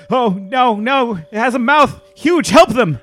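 loud, high panicked pleading; panicked cry. Acted. Tobias begs Jake to help, loud panicked pleading. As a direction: beg for help in terror. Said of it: "Tobias cried".

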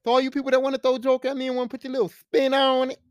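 normal talking voice

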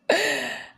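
high, quick laugh; real embarrassed titter; short giggle. Real, not acted. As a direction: giggle at your younger self. Laughing at her young self.

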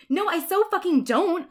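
high, loud indignant shout; shouted denial. Acted. Ebony denies liking Draco to Willow, shouting. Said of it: "I shouted".

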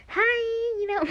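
high, drawn-out awkward "hi"; awkward greeting. Shown as a demonstration. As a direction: put on a squeaky-high, awkward hello. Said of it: "Not something, you know".

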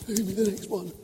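put-on high toddler voice; hungry baby's demand. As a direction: ask like a baby wanting the next mouthful.

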